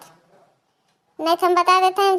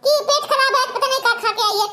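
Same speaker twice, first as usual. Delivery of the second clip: mocking imitation; 7 semitones higher than usual. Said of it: "क्या कर रही थी ना".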